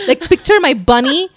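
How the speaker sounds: ordinary talk, nothing marked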